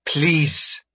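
high, earnest plea; repeated plea. A narrator's reading. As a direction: beg the captor to stop. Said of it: "Marie repeated".

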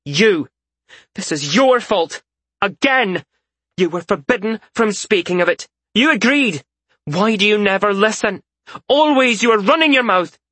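high, loud furious scolding; furious tirade. A narrator's reading. Isaria rounds on Malseus blaming him, high and loud. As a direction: lay into him with rage. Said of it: "Isaria rounded on Malseus".

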